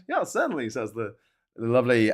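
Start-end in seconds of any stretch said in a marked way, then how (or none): 0.1-0.7 high-pitched imitation of a café server; lightly voiced Italian lady. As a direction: lightly mimic a lady behind the counter, pitched up. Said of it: "Italian lady on the other side".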